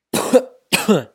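sudden hacking cough; choking cough. Real, not acted. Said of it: "I'm, like, choking right now".

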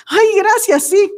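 high, loud, happy thank-you; delighted thanks. Pretend. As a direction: accept the compliment gladly. Acting out accepting a compliment. (agradecimiento alegre, agudo y fuerte)

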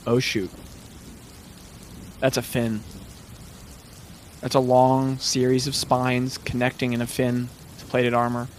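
low, hushed dread; grave, low voice with heavy pauses; ominous game master narration. Acted. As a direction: let the danger sink in, low and soft. Game master voices dawning realization.

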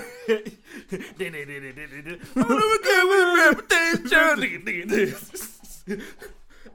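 high, loud nonsense babble breaking into laughter; mock a rushed promo in squealed gibberish; laughing, hammed-up babble. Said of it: "she's rushing the promo".

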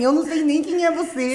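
high cheeky dismissal; sassy retort. Retold or imitated. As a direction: toss it off cheekily. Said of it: "eu falei assim".

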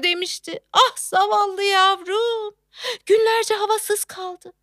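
high, wailing pity; tearful lament. Acted. Mother pitying her poor airless baby, tearful. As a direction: wail with pity, slowing at the end.

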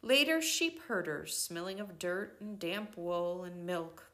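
ordinary delivery, nothing marked

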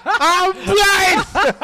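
high, laughing mock yell; imitated panicked shouting. Retelling a dormitory toothpaste prank, mock shouting. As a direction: cry it out high, half laughing. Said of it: "somebody was shouting".

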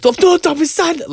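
high, loud mock commentator yelling; intense German commentator shout. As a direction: imitate a fired-up German commentator. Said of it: "intensity behind their voices".

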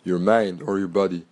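ordinary delivery

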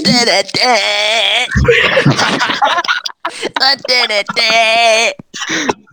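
high, drawn-out te-ne-te-ne tune; sung fanfare. Pretend. Player drowning out a question, high sung fanfare. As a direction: belt out a high wordless jingle. Loud wordless tune over others.

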